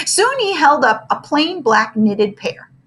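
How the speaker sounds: ordinary delivery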